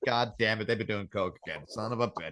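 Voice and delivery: low, soft exasperated grumble; muttered annoyance. Retold or imitated. Scientist catching colleagues on coke again, grumbling low and soft. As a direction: sigh it out under your breath. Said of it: "It's like".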